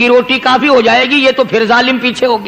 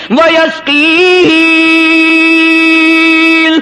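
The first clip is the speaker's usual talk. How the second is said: slow, raised, drawn-out praise; fervent preaching; 4 semitones higher, slower than usual. Acted. Preacher listing God's gifts to the crowd, slow and raised. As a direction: hammer each اس in turn, slowly.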